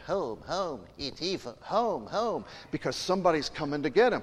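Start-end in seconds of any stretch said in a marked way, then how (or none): 0.1-2.5 lightly voiced alien; a hint of E T calling home. Preacher retelling the film, lightly voiced E T. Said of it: "E T's going".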